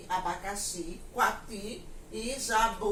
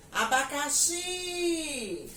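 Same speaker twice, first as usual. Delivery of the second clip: "Abacaxi" with a long, high, held XI; slow, raised demo shout on the XI of "Abacaxi"; stress on the last syllable; 6 semitones higher, 3 dB louder, much slower than usual. Shown as a demonstration. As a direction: call out Abacaxi, stretching the final "xi". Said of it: "eu vou dizer javali".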